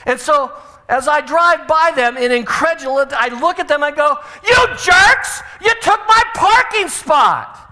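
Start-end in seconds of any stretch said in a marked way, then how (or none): 4.5-7.6 put-on high furious shout at the girls; indignant driver yelling out the window; angry yell. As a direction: yell at them in outrage.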